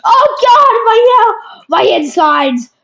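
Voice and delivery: high, loud mock agony; mock pain cry. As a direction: overdo the pain. Hit, crying out in pain.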